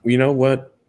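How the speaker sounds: ordinary talk, nothing marked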